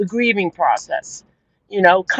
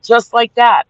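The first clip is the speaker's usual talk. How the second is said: indignant; 4 semitones higher, 6 dB louder than usual. Real, not acted. Angry recounting.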